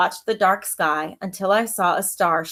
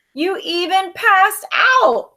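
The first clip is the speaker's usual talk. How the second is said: high, slow gleeful chirp; little brother gleefully teasing; 12 semitones higher, 5 dB louder, slower than usual. Acted. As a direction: chirp it out delighted. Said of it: "my brother chirped".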